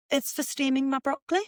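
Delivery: posh accent put on for a retold reply; posh flatmate. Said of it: "the two girls that were well posh".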